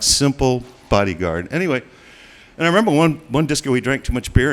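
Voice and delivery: ordinary talk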